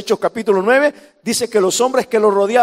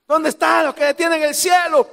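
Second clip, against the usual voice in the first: higher, shouted mockery of a worship leader; 7 semitones higher than usual. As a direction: yell it like a rowdy church leader. Mocking noisy worship call.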